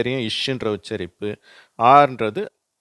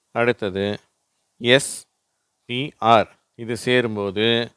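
ordinary talk

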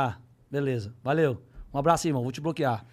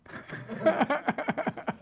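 giggle; 10 semitones higher than usual. Retold or imitated. Neymar laughing on the phone.

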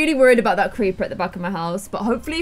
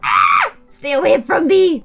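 sudden loud panicked yell; startled yell; 4 semitones higher, 6 dB louder than usual. Real, not acted. Gamer startled by a creeper, panicked yell.

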